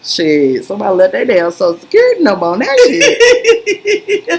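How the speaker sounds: words through laughter; giggling through the line; laughing while talking. Real, not acted. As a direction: talk while cracking up. Laughing while telling joke.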